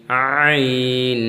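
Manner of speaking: আইন drawn out very slowly, squeezed from the throat; pressed ain. Shown as a demonstration. Teacher stretching আইন for learners to copy. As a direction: hold আইন long and tight in the throat. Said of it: "আইনের উচ্চারণটা pressure দিতে হবে".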